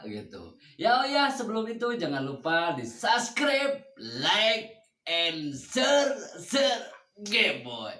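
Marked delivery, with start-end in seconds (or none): 2.8-7.9 drawn-out announcer call. Acted.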